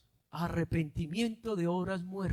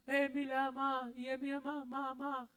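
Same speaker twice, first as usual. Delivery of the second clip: soft sing-song syllable drill, softer; child's sound-out drill; 4 semitones higher, 10 dB quieter than usual. Retold or imitated. Preacher softly imitating a child's spelling drill. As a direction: chant it like a kid learning letters. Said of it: "Uno le enseñaron eso".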